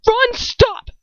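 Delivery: sudden high, loud cry to stop; urgent high-pitched yell; shout. A narrator's reading. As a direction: shout out to halt him. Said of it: "I shout".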